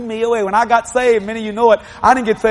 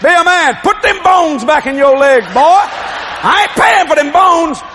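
louder, higher barking orders, slow at the end; mock yelling dad; 6 semitones higher, 4 dB louder, slower than usual. Dad and grandpa yelling at hurt boy, mock shout. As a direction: bark it like a tough old father.

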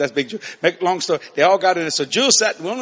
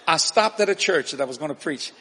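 ordinary delivery

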